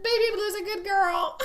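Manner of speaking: high, laughing parrot voice; imitating the bird. Copying her mom's parrot, in a high laughing bird voice. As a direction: squawk it like the bird would. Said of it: "It goes".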